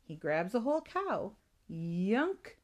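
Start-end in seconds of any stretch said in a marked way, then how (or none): 1.7-2.6 drawn-out yunk sliding upward; giant's gulp. A narrator's reading. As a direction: make a rising monster gobble noise. Imitates giant gulping down cow.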